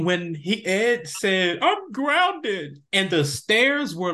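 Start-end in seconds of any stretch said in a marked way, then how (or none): 1.6-2.8 put-on whiny grounded kid. Ed quoted in retelling.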